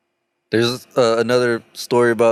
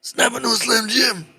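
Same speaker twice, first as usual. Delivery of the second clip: high, throat-straining wrestler impression; hammed-up high pitchman holler; Randy Savage; 12 semitones higher than usual. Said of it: "did that hurt my throat".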